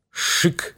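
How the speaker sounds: soft unvoiced "shik"; whispered hiss. A narrator's reading. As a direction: hiss it out under the breath. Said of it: "словно кто в полголоса шепнул".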